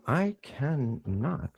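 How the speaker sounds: normal talking voice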